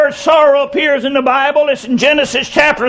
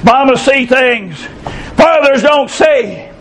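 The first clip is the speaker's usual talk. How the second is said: slow refrain; slower than usual. Acted. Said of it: "closing refrain, slowed".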